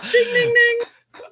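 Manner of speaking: high sing-song ding like a game show bell; playful high bell imitation; mock bell. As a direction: chime it like a quiz buzzer. Cohost marks a correct answer.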